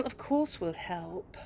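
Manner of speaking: ordinary talk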